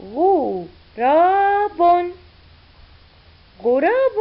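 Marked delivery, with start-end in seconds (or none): 0.0-2.1 slow blended reading. Shown as a demonstration. Teacher blending letters for pupils, very slowly drawn out. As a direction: stretch غراب out slowly, sound by sound. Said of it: "والحرف الأخير بن".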